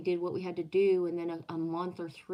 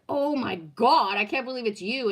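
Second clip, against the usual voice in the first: loud astonished greeting; 5 semitones higher, 9 dB louder than usual. Retold or imitated. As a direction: belt it out like you can't believe your eyes. Recognizes the auditor walking in.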